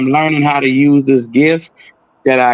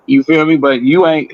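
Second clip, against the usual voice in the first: usual speaking voice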